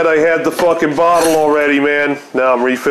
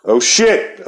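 ordinary delivery

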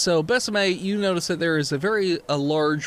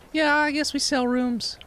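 disappointed; 8 semitones higher than usual. Acted. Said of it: "they look disappointed".

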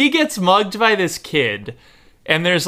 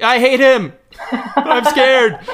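laughing, higher and louder mock-scared cry; mock whimper; 9 semitones higher, 4 dB louder, slower than usual. Joking about her own fear, a laughing mock-scared cry. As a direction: fake a silly frightened wail through laughter. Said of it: "I would just be like".

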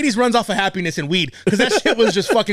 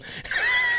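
shrieking laugh; 6 dB quieter than usual. Real, not acted.

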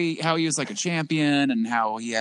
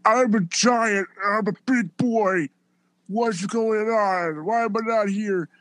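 higher, slow dopey giant voice; lightly mocking the big man in a higher voice; mocking Big Show; 7 semitones higher, slower than usual. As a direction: play the whiny giant. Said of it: "just typical, like".

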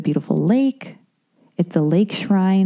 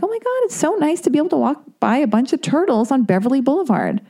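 higher, gushing re-enactment; retold delight; 7 semitones higher than usual. To herself passing the pond, mock-gushing delight. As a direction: gush happily, pitched up. Retelling her delight at turtles.

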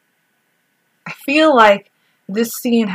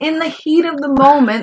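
raised, louder emphatic delivery; emphatic declaration; 6 semitones higher, 7 dB louder than usual. Real, not acted. Co-host excuses a grope in battle, loud and emphatic. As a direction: declare it loudly and high. Mock excuse, then sings the phrase.

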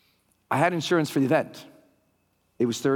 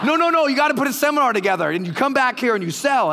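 high, loud, eager push; the promoter insisting with high, loud eagerness; excited insistence; 8 semitones higher, 4 dB louder, faster than usual. Retold or imitated. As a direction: push him hard to sell. Mark insisting retold.

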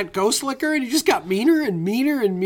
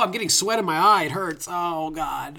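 normal talking voice

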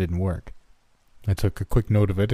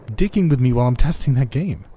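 loud, higher accusation; heated, raised-voice complaint; angry; 5 semitones higher, 5 dB louder than usual. Acted. Tanner accusing Nick of pranking him, loud and angry.

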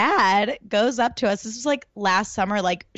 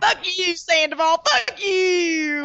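put-on much higher, whiny Southern country accent; Brittany's accent; 8 semitones higher, slower than usual. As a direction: ham up a Southern country girl cursing in accent. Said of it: "Wait, do it again".

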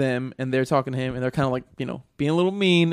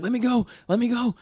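frantic plea; 9 semitones higher than usual. Retold or imitated. Drowning kid begging.

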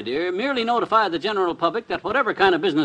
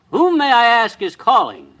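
loud, high start, falling grandly; self-important phone manner; 4 semitones higher, 6 dB louder than usual. Acted. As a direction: ask like a very important businessman. Puts on importance for caller.

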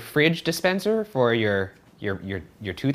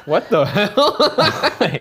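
laughing; 8 semitones higher, 3 dB louder, much slower than usual. Real, not acted. Laughing at baby joke.

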